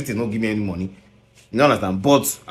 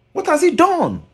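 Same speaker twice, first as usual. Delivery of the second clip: the same question again, far higher and pressing; high incredulous repeat. Real, not acted. Challenging the singer about his uncle, high incredulous demand. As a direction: fling it up high in disbelief.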